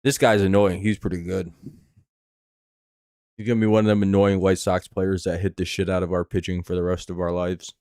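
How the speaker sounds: ordinary talk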